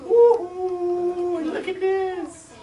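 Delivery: high whoop, then a slow delighted look at this; thrilled whoop. Retold or imitated. Gina crowing to her siblings over the trap, thrilled. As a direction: show off the find gleefully.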